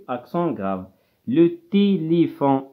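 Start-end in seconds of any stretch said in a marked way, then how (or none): 1.3-2.6 Le téléphone said very slowly, each syllable drawn out; careful dictation. Shown as a demonstration. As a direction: spell out Le téléphone slowly for pupils. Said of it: "إذن هنا".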